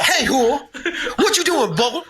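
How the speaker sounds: imitating a sergeant calling out to a soldier, high and laughing; drill sergeant impression. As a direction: holler at recruits like a hammed-up sergeant through laughter. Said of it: "he'd be like".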